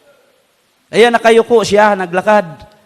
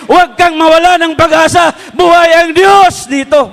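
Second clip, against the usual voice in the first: excited plea; 5 semitones higher than usual. Retold or imitated. Husband urges his dying wife to rise, building to fervent excitement. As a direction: urge him up, growing fervent. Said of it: "sabi niya".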